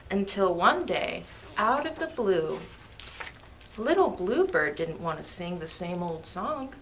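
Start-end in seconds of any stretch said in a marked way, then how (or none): none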